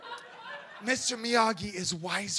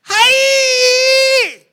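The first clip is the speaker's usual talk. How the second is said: much higher, much louder battle shout; karate yell; 15 dB louder than usual. Retold or imitated. Miyagi yelling over the kneeling thug, fierce shout. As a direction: explode with a martial arts cry.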